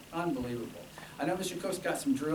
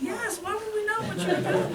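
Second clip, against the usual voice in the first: mock incredulous; 4 dB louder than usual. Retold own incredulous reply.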